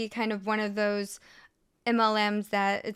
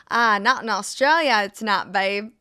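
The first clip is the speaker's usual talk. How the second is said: a loud, hammed-up Australian accent; a clumsy fake Australian accent; bad Australian accent; 7 dB louder than usual. Shown as a demonstration. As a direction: play it broadly Australian, a bit off. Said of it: "I'm sorry for the bad accent".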